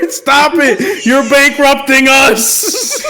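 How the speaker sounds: high, slow, laughing protest; laughing mock plea. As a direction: beg through the laughter.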